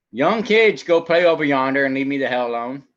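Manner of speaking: put-on mom yelling at kids. Mom in the kitchen yelling the kids out. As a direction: yell at the kids to get lost. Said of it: "Mama's cooking in the kitchen. Yelling at the kids".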